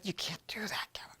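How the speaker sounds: whispered scolding from his wife; hushed warning. Retold or imitated. Wife whispering a warning to her husband, hushed scold. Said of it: "She said".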